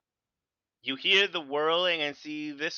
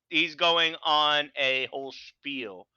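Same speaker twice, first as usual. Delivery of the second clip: ordinary talk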